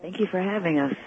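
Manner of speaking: distant voice on a phone line; talking off the mouthpiece; phone held away. Real, not acted. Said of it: "I'm not hearing you too well".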